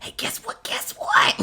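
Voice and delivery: hushed and eager, unvoiced; excited whisper. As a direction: whisper it like a thrilled kid. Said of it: "an excited whisper".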